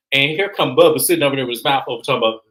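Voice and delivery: ordinary talk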